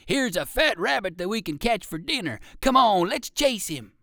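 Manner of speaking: a hungry dog calling its pal to the chase. A narrator's reading. Dog calling to another dog, eager and loud. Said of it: "cried this dog to another one".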